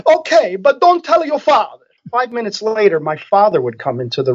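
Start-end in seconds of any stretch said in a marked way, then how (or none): 0.1-1.8 put-on Italian accent. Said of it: "she would say".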